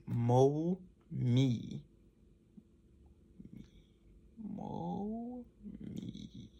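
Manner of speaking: exaggerated "Mo, me" lip drill, fading to silent mouthing; lip exercise mouthing. Shown as a demonstration. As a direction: mouth "Mo" and "me" with big lip movements, the second round almost silent. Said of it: "keep mouthing".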